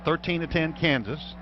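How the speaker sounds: normal talking voice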